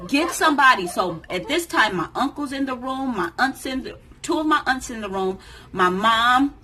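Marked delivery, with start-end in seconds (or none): 0.1-0.9 loud, high retold cry; urgent call for help. Labouring mother calls for help, urgent and loud. Retold panicked plea in labor.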